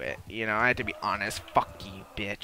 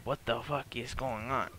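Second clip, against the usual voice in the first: normal talking voice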